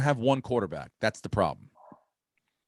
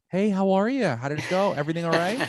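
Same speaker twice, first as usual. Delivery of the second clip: fake-cheery agent; 7 semitones higher than usual. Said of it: "they called me up".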